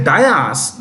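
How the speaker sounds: dais as two syllables, "DIE-as"; incorrect pronunciation demo. Shown as a demonstration. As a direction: say dais with an "eye" vowel, then "as". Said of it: "This is incorrect".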